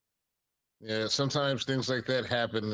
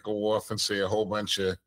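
normal talking voice

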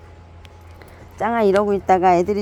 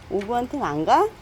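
ordinary talk

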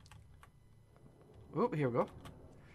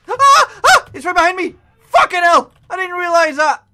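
high, loud terrified yelling; real panicked screaming; 8 semitones higher, 13 dB louder than usual. Real, not acted. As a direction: scream as it catches you. Chased by monster in game.

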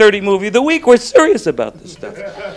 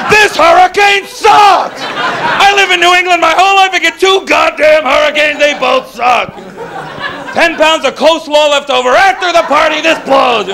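high, hammed-up angry rant; outraged, cheated party host's tirade; pissed off; 8 semitones higher than usual. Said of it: "And we were pissed again".

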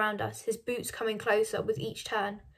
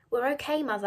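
ordinary delivery

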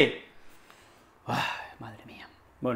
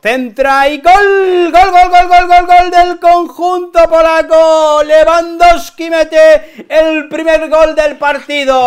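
high, loud, drawn-out goal scream; goal call; 11 semitones higher, 9 dB louder, slower than usual. Acted. Fan calling Lewandowski's goal like TV commentator, drawn out.

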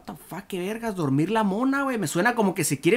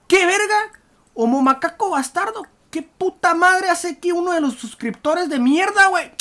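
loud, high-pitched disbelief; outraged shouting; 10 semitones higher, 5 dB louder than usual. Real, not acted. Gamer finds his subscriber in the game, outraged shouting. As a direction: burst out in incredulous outrage.

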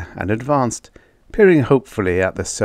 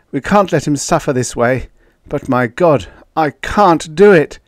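anguished; 6 semitones higher, 6 dB louder than usual. Acted. Anguished plea over dying friend.

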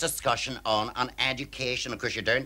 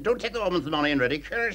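quick mock telling-off; 6 semitones higher, faster than usual. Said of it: "She said".

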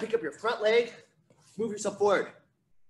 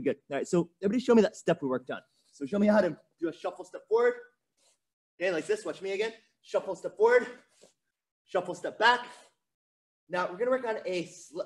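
normal talking voice

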